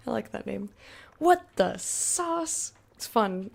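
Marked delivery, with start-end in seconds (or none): 1.2-2.7 very slow, much higher drawn-out "What the sauce?"; silly high voice. Pretend. Streamer repeating a chatter's name for fun, goofy high. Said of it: "It's fun to say".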